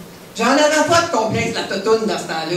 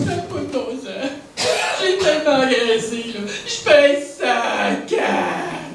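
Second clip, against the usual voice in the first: drawn-out, exaggerated tearful wail; mock bawling; 3 semitones higher, slower than usual. Girl in the school locker room wailing about her weight, mock bawling. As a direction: imitate a schoolgirl bawling in despair. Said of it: "j'entends une fille qui braille".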